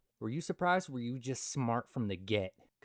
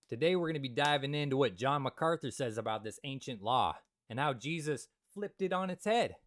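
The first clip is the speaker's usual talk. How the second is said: ordinary talk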